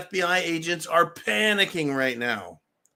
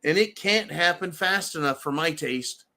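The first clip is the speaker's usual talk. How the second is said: usual speaking voice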